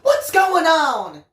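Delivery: high, slow, loud demand; a sharp nurse's demand. A narrator's reading. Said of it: "she demanded".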